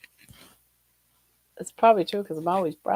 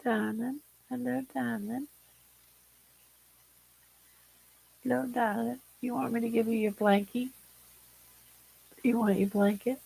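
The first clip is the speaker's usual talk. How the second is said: soft pet talk; 4 semitones higher, 7 dB quieter than usual. Real, not acted. Gently cooing to her pet about its blanket, soft baby talk.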